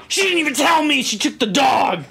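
high, fast aggrieved outburst; mock indignant complaint. Dog owner complaining about his daughter, indignant outburst. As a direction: imitate an owner protesting at high pitch.

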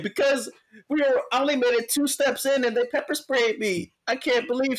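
high whiny mock sob; mock crying. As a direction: imitate a crying woman in a high voice. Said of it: "she's crying".

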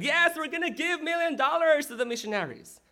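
high-pitched excited mock cheer; imitated eager kids. Preacher voices kids promising a million, high mock scream. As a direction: pipe up like children all agreeing at once. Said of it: "They all screamed".

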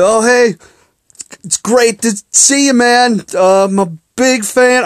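high, gushing fan voice; mock starstruck greeting. Fan greeting his hero on Skype, mock gushing. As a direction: pitch it up like a nervous fan.

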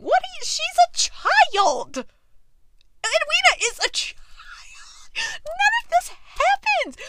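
squeaky high cry breaking into whisper; anguished wail. Real, not acted. Fan appalled at Anthony courting Edwina, crying whisper. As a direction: cry out then sob it in a whisper. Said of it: "I am upset".

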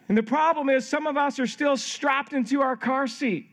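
high-pitched, impassioned sermon delivery; fervent preaching. Real, not acted. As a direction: drive the message home to the congregation. Preaches the application with fervor.

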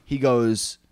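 normal talking voice

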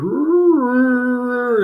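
high, loud imitated Yeti howl; Yeti roar. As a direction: let out a drawn-out mock monster roar. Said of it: "like the Yeti goes".

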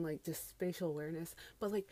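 usual speaking voice

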